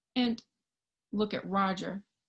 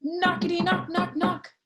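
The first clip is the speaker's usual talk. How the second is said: higher, louder knockety knock; knocking sound; 7 semitones higher, 4 dB louder than usual. Acted. Roger pounding the wall, loud knock sounds. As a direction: rap it out hard on the wall.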